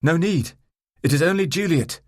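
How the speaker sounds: loud, high call to a window; shout up. A narrator's reading. Man calling up to his brother at the window, shouting. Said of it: "shout towards the window".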